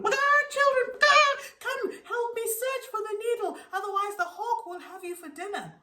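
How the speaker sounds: high, loud, crying hen voice; desperate mother hen, near tears; frantic hen calling. A narrator's reading. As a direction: cluck it out in a panic to the chicks. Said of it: "calling out to her children".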